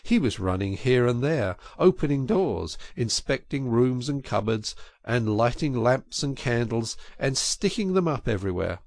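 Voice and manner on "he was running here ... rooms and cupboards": usual speaking voice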